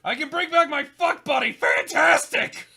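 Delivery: lightly voiced manic gamer; gleeful unhinged ramble; manic character voice.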